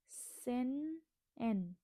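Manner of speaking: सेन एन drawn out very slowly, two separate words; stretched teaching demo of सेन एन; slow pronunciation. Shown as a demonstration. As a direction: model सेन एन slowly for learners. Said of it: "ठीक है, सेन एन".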